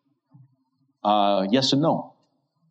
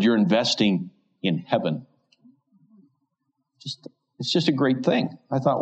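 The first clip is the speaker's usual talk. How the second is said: ordinary delivery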